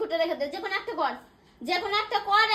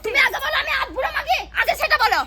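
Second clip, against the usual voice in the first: indignant, high-pitched demand; angry shouting; 4 semitones higher, 5 dB louder than usual. Acted. Stung by an insult in a family row, angry shouting. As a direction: shout it back as an outraged challenge.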